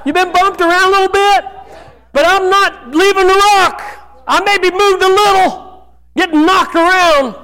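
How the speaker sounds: rising preacher fervor. Real, not acted. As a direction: lift the crowd, voice climbing. Preacher rising to emphatic call.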